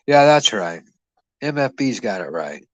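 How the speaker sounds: ordinary talk, nothing marked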